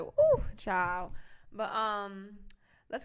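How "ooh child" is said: loud, drawn-out ooh of being overwhelmed; overwhelmed exclamation. Acted. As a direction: let out a big ooh at how heavy it was. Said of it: "my energy is like".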